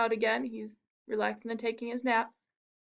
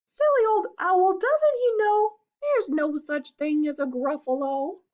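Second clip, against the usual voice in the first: high, louder giggly mouse; smug mouse; 9 semitones higher, 6 dB louder than usual. Acted. Mouse laughs at the fled fox, smug. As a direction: gloat like a cheeky mouse.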